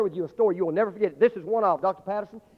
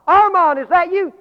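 much higher and much louder shout; yelling down; 11 semitones higher, 14 dB louder, slower than usual. Acted. Father shouts down into the rubble for his son. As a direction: yell into the dark hole. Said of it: "he yelled down to that dark hole".

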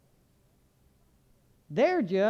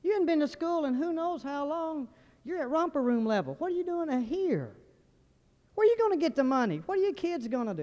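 lightly voiced granddad, higher-pitched scolding; a hint of a nagging granddad, pitched up; 6 semitones higher than usual. Granddad questioning the boy at college, lightly acted high-pitched scolding. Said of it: "my granddad would say".

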